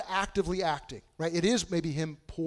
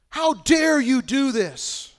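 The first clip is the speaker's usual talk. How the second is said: high, loud and slow, "How dare you" spat out; hammed-up outrage, dragging every word; indignant outburst; 8 semitones higher, 7 dB louder, much slower than usual. Customer yelling at Honda over the phone, mock furious.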